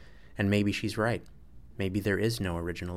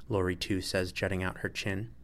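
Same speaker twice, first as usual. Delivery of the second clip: usual speaking voice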